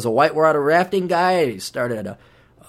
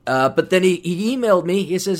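normal talking voice

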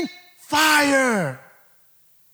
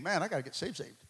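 quiet, lower, as a churchgoer thinking to himself; mock inner thought; 9 semitones lower, 15 dB quieter, faster than usual. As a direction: mutter the thought like a worried churchgoer. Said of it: "Some of you guys are thinking".

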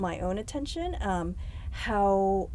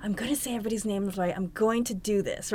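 eager resolve; 3 dB quieter, faster than usual. Retold or imitated. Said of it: "I was like".

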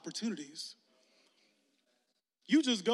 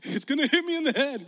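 put-on scared kid; 2 semitones higher, 6 dB louder than usual. Pastor acting a scared double dutch jumper, mock panic. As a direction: squeal it like a kid afraid of the rope. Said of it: "and you get scared".

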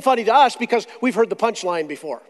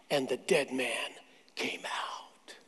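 hushed awe; 10 semitones lower, 15 dB quieter, much slower than usual. Acted. Preacher telling the congregation of the dead man rising, whispered. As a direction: drop to a soft, awed whisper. Awed reading of miracle.

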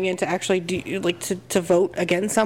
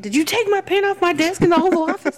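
berserk rage; 10 semitones higher than usual. Pretend. Pretend president threatening staff.

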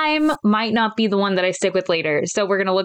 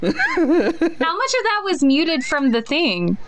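laughing; 7 semitones higher, slower than usual. Real, not acted. Laughing after her yell.

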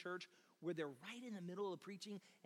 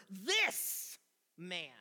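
"this" set apart and emphasized; stress on THIS; 7 dB louder than usual. Shown as a demonstration. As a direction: punch "this", pause, then let "man" fall. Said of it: "I wanna emphasize this word".

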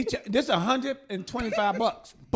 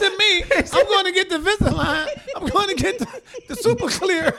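high, heated mock protest; retold protest; 6 semitones higher than usual. Client to his dentist friend, an indignant high protest. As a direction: push back on the dentist, hammed-up. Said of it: "I said".

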